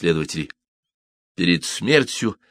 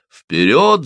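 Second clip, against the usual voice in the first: loud, high battle cry; shouting; 6 semitones higher, 6 dB louder than usual. A narrator's reading. Guardsmen following their commander down, shouting. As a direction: shout it as a charge. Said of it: "закричали национальные гвардейцы".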